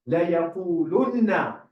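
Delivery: لَيَقُولُنَّ recited fast with doubled noon held; emphatic recitation. Shown as a demonstration. Teacher reciting the word to show its emphasis. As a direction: stress the shadda on the noon in لَيَقُولُنَّ. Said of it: "It's emphatic".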